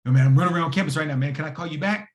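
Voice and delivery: fast, loud, hurried phone voice; a busy man cutting the call short; rushed mentor. Retold or imitated. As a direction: blurt it while running around.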